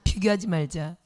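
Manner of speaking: preacher leading the congregation in a loud refrain; call to repeat. Shown as a demonstration. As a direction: lead them in saying it back. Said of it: "따라합시다".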